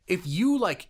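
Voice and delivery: ordinary delivery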